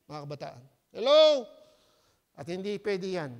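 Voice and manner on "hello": loud call. Real, not acted. Preacher calling out to congregation.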